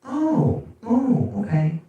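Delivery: high, lightly voiced flustered woman; mock woman caller. Woman caller giving up on his name. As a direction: fumble it like a caught-off-guard caller.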